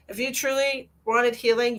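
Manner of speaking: ordinary delivery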